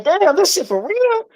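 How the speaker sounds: high, slow, drawn-out disbelief; stunned disbelief. Retold or imitated. Retelling the street call, stunned high disbelief. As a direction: let it rise way up in shock. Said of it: "I'm like".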